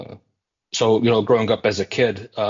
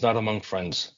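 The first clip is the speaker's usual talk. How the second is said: ordinary talk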